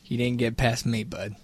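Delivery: soft low teasing; quiet teasing. Real, not acted. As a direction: tease him under your breath.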